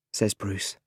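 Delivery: usual speaking voice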